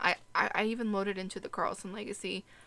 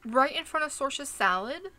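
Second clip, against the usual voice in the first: indignant; 6 semitones higher, 7 dB louder than usual. Real, not acted. Indignant at flirting sim.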